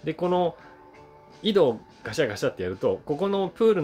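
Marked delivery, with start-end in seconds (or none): none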